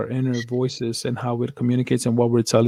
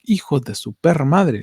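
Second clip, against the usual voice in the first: stunned swearing; 2 semitones higher, 4 dB louder than usual. Retold or imitated. Retells own stunned reaction to realization.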